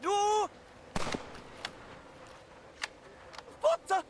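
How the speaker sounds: very high call breaking off in shock; shocked outcry. Acted. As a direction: cry out as the man is shot. Caller sees man shot.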